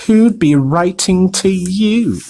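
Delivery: mock the boy, slowly; sneering. A narrator's reading. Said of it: "sneered Uncle Vernon".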